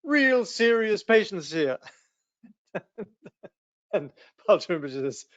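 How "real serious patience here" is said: high, loud indignant outburst; hermit losing it. Retold or imitated. Hermit shouting at the lama, offended and much louder. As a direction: snap back in offended outrage. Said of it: "the hermit lost it".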